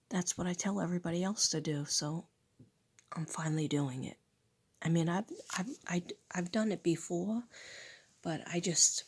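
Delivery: ordinary talk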